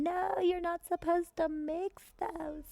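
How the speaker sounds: high, slow, drawn-out reprimand; exaggerated high character scolding; mock scolding. Acted scolding over a lab fire.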